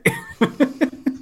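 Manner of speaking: high chuckle; a short, high burst of laughter; laughing. Real, not acted. Reacts to the vote tally. (risa aguda)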